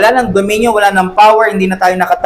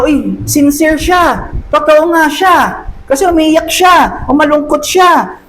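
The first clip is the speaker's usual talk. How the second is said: high hammed-up amazement, near shouting; mock impressed onlookers; 8 semitones higher than usual. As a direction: gush like a crowd won over by tears. Voicing impressed onlookers after crying.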